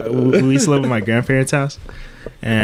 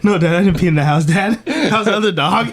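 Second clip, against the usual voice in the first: pleading dog voice; 7 semitones higher than usual. Pretend. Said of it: "voicing the dog".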